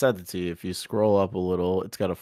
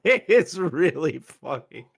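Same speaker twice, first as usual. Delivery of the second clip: slow, higher laughing delivery; drawn-out laughing; 7 semitones higher, 4 dB louder, much slower than usual. Real, not acted. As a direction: stretch it out while laughing.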